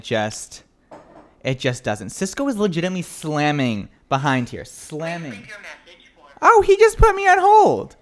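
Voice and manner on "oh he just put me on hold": high outraged disbelief; shocked. Real, not acted. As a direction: squeal in disbelief. Surprised at being put on hold.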